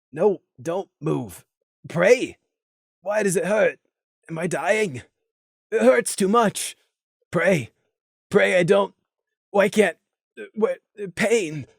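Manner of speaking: a lightly voiced weak, hurting murmur; faint words from a wounded pony; softly voiced struggling plea; Gloom's voice, wounded. A narrator's reading.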